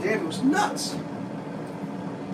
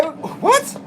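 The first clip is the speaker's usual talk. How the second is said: baffled what; 11 semitones higher than usual. Retold or imitated. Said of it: "And I'm like".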